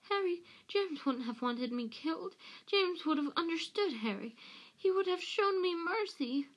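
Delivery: high-pitched begging; pleading Pettigrew. A narrator's reading. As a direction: plead for mercy, voice climbing high. Pettigrew begs for mercy.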